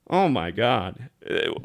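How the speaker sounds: ordinary talk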